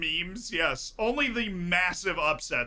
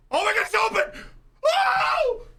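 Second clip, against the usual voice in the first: very high, loud celebration shrieks; real full-voice euphoric yelling; ecstatic goal screaming; 7 dB louder, much slower than usual. Real, not acted. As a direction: scream the goal in disbelief, stretching the cheers. Celebrating Australia's surprise goal live.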